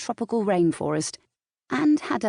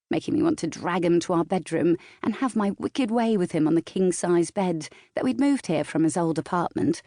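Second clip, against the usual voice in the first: plain reading voice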